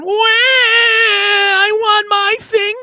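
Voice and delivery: put-on high, drawn-out whiny baby wail; hammed-up child's tantrum cry; mock crying child. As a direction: wail it like a bratty toddler losing a toy. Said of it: "like a child screaming because their rattle stops".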